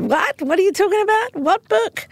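high, incredulous questioning; high-pitched confused manager; baffled. Retold or imitated. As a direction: sound totally baffled by the book. Retelling John Landau's bafflement.

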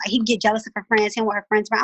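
ordinary delivery

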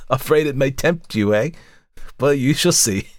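high, teasing hag voice; sly old woman taunting the lawyer; mocking old crone. A narrator's reading. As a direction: leer at him with a wheeze. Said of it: "laughed again, the same curious, toneless chuckle".

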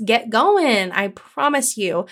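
ordinary talk, nothing marked

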